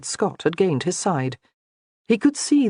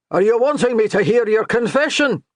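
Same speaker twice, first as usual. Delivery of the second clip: a mocking call, higher and louder; shouted back; 6 semitones higher, 4 dB louder than usual. A narrator's reading. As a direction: shout back with a hint of mockery. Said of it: "the priest shouted back".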